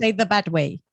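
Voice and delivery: ordinary talk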